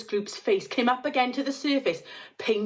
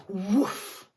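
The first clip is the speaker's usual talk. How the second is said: ordinary delivery, nothing marked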